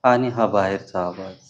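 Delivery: calm guiding voice with long pauses; unhurried, even phrases, leaving long silences; guided meditation. Acted. Teacher turns the group's attention to the breath, guided meditation.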